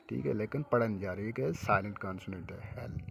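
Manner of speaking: ordinary talk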